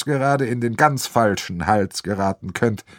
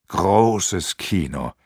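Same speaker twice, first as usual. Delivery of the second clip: slow, lower inner thought; delighted thought; 5 semitones lower, much slower than usual. Acted. Arno thinks to himself, slow and charmed. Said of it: "denkt der Arno ganz verzückt".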